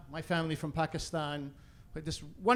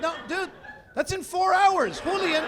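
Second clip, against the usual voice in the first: high, loud incredulous pleading; exasperated protest; 11 semitones higher, 5 dB louder than usual. Acted. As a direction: protest at full pitch to a friend. Exasperated protest to Julian in club.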